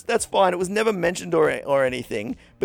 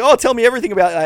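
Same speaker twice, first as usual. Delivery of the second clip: eager question; 6 semitones higher, 7 dB louder, much faster than usual. Retold or imitated.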